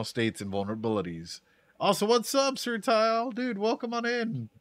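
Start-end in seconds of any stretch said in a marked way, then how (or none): 1.8-4.5 much higher, bright hello to a viewer; high, upbeat shout-out to a chatter; excited greeting. Real, not acted. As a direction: perk up to greet a friend who just arrived.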